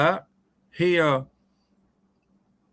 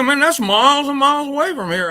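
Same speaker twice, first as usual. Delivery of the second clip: higher, louder disbelieving protest; incredulous protest; 10 semitones higher, 6 dB louder than usual. Retold or imitated. Boy balking at riding out to Logan, loud incredulous protest. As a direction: balk at how far it is.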